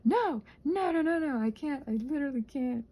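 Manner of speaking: normal talking voice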